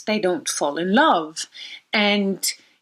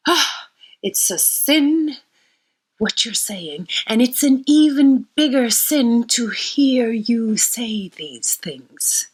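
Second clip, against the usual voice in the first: mild fury; 5 semitones higher, 3 dB louder, slower than usual. Retold or imitated. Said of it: "And she's furious".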